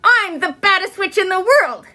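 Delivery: high shouted witch boast; a child yelling she is the baddest witch. A narrator's reading. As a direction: bellow it like a proud little witch. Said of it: "yelled Ramona".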